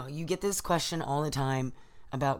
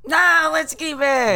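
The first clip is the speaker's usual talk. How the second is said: loud protest; 10 semitones higher, 11 dB louder, slower than usual. Real, not acted. Protesting edit, mouth full.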